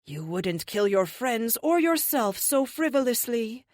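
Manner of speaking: feigned confidence. A narrator's reading. Witch to armed captive, confidence she doesn't feel. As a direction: sound sure of yourself in a put-on voice. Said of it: "she says with confidence".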